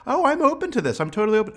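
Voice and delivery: high, breezy mock insistence; hammed-up claim of being open; imitating a guarded client's high chirpy protest; skeptical client. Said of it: "they always say".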